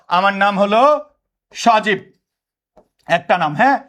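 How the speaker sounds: ordinary talk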